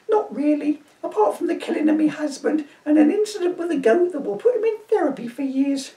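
put-on high shrieking old woman; an indignant old dear shooing a Viking; old lady to constable, high and indignant; crone voice. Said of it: "my crone voice".

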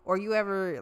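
usual speaking voice

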